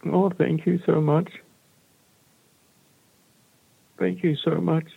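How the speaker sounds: high, moved thanks; real, overwhelmed gratitude; choked with emotion. Real, not acted. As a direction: thank them while close to tears, voice rising high. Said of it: "I'm quite emotional".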